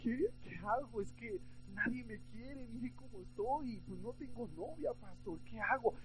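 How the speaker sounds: a touch of fake sobbing; mock crying plea. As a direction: beg the pastor in a hammed-up, weepy, soft voice.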